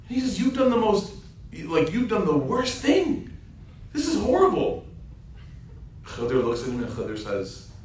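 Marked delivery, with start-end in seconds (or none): 0.4-4.9 lightly voiced shocked complaint; a mild, upset rebuke, slowed in the middle; high indignant protest. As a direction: scold him in a high, outraged voice. Musa scolding the hole-maker.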